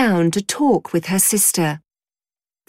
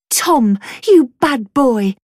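scolding mother; 5 semitones higher, 4 dB louder, slower than usual. Acted. Mother scolds her son.

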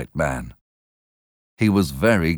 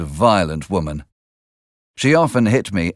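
plain reading voice

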